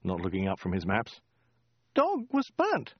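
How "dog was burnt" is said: high falsetto report; squeaky woman. A narrator's reading. Woman telling the Khan her day, high squeak. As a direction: offer it up in a squeak.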